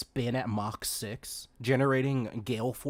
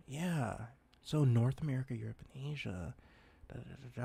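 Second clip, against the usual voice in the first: normal talking voice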